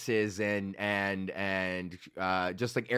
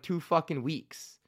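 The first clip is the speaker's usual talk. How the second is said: usual speaking voice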